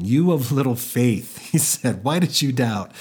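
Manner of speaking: amused, acted laughing through the words; laughing Jesus. Movie Jesus to Peter back in the boat, laughing. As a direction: tease him lightly through a chuckle. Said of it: "while laughing".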